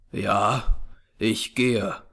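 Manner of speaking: low, stricken reply; weary resignation. A narrator's reading. Jefferson rising with effort to leave, wearily resigned. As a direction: answer heavily, pitch dropped. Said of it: "sich mühsam erhebend".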